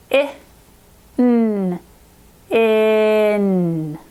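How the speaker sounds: in as separate sounds, then stretched together; slow decoding of in, n held; sounding out. Shown as a demonstration. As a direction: split in, then blend it long. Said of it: "sounding that one out".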